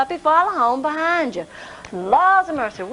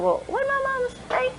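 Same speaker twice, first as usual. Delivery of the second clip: put-on high, hushed little-boy wondering; puzzling it over quietly; boy pondering; 12 semitones higher, 6 dB quieter than usual. Boy thinking aloud.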